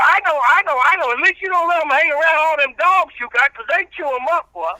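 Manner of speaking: country preacher character. Acted. Old reverend preaching patience to the radio host, in full preacher voice. Reverend rants about dog toys.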